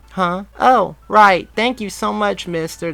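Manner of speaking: put-on high, bright kid voice; young boy. A narrator's reading. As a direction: play an eager little boy. Voiced boy thanking Barry.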